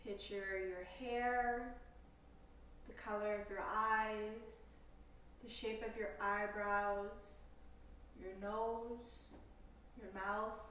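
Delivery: slow, calm meditation voice with long pauses; guided meditation. Acted. Yoga teacher leading listeners through a compassion meditation, calm guided delivery. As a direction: guide them unhurriedly into stillness, leaving space between phrases.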